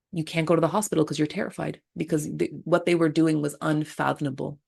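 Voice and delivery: usual speaking voice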